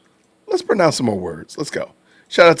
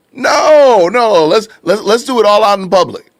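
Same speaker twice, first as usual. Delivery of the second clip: high, loud mock exclamation; sarcastic outburst; 7 semitones higher, 6 dB louder than usual. Sarcastic mock outcry at absurd idea.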